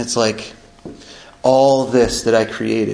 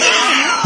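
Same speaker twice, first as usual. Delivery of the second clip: burst of laughter; 13 semitones higher than usual. Real, not acted. Laugh after Sue's joke.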